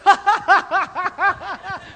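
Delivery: loud, high mock belly laugh; hammed-up cackling burst ending on a high note; imitated kids' laughter. Boys laughing at piles of gifts, loud and hammed-up. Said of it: "they're, like, laughing".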